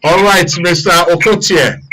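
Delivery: usual speaking voice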